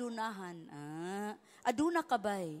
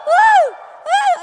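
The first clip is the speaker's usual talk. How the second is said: high, loud joyful woo; loud high celebratory woo; cheering whoop; 15 dB louder than usual. Real, not acted. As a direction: whoop to lead the crowd. Congregation cheering.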